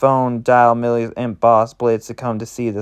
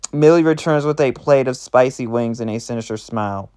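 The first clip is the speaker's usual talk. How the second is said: plain reading voice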